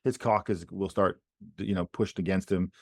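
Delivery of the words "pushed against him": ordinary talk, nothing marked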